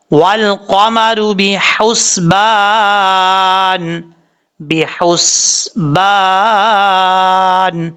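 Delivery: بحسبان chanted very slowly with a light ہا; correct recitation. Shown as a demonstration. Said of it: "اس طریقے سے پڑھنا ہے". (ہلکی ہا کے ساتھ آہستہ تلاوت)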